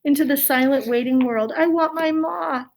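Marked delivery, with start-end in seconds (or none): 1.5-2.6 crying for ma. A narrator's reading. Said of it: "cried Mig".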